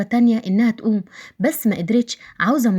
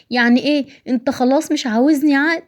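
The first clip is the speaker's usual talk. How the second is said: higher-pitched, hurt questioning; hurt wife; 7 semitones higher than usual. Acted. Wife asking whether her husband still wants her, hurt higher voice. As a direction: ask as a rejected wife, pitch lifted.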